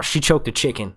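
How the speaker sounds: ordinary delivery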